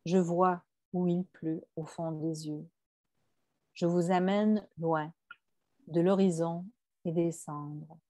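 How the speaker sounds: subdued, unhurried verse reading; poetry reading. Acted. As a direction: read each short line apart, letting it settle. Reads a quoted poem aloud.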